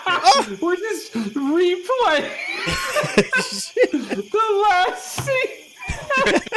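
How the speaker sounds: words squeezed out through high laughter; can barely get it out for laughing. Real, not acted. Laughing fit over the replay.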